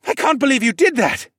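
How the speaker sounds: high, loud breathless excitement; worked-up, amazed exclamation; exclaiming. Acted. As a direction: marvel at a friend, tripping over the explanation. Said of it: "he exclaimed".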